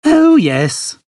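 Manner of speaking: put-on high, quavering old man voice; a gentle old man speaking kindly. Narrator voicing the old man, high and kindly.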